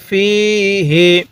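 "ফিহি" slowly with a drawn first vowel; elongated recitation. Shown as a demonstration. Teacher demonstrating the vowel length, slow recitation. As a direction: stretch the ii in ফিহি. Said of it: "ফায়ের মধ্যে এক আলিফ".

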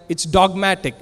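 ordinary delivery

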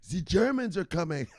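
put-on high, heavy German accent with a z for th; hammed-up German warning; German accent, high and emphatic; mock German accent.

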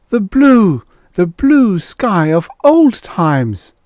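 high, loud, drawn-out marvelling; exclaimed wonder. Acted. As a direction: cry out slowly in amazement. Marvelling at returning blue sky.

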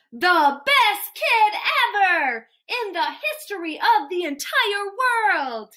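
each word punched out high; grand proclamation. Acted. Grand praise of the listening child.

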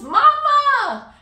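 high, full-volume joyful holler; imitated long-distance greeting yell; calling across field. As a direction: shout it to someone far away. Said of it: "across the field, he's like".

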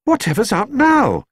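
high-pitched startled exclamation; exclaiming. A narrator's reading. Judy hearing bangs from next door, exclaiming. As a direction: exclaim it high, taken aback. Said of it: "exclaimed Judy".

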